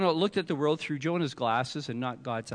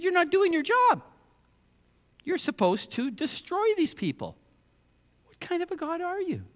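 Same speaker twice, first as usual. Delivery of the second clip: much higher, strained accusing cry; Jonah attacking God; 3 dB louder than usual. Retold or imitated. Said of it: "He attacked God".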